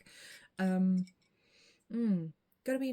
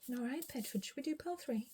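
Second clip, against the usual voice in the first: pet talk; 5 semitones higher, 5 dB quieter than usual. Real, not acted. Asks her cat.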